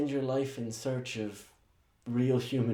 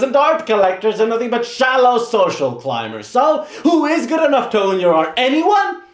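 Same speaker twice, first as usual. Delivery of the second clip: louder and higher, climbing to a yelled question; building furious tirade; 6 semitones higher, 4 dB louder than usual. Acted. Assistant berates the painter, building to a high furious yell. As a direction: let the rant build to a scream.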